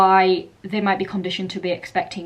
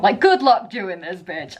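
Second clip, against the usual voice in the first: ordinary talk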